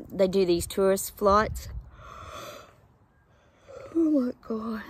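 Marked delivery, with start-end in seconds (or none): 3.9-4.8 yawning oh my God. Real, not acted. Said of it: "Sorry about the yawning".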